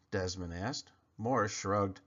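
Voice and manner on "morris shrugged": ordinary delivery, nothing marked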